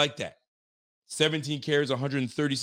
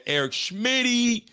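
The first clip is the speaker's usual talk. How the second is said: much higher, slow hype on the name; stretched, high-pitched Eric Schmitty; drawn-out name call; 10 semitones higher, 4 dB louder, slower than usual. Real, not acted. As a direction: announce it like a ring announcer. Announcing a super chat name.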